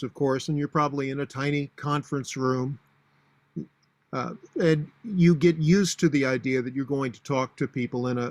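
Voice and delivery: ordinary delivery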